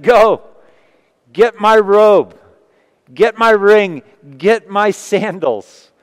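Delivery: loud, high, commanding call to the servants; joyful command. Acted. Father shouting to his servants to fetch robe and ring, loud and joyful.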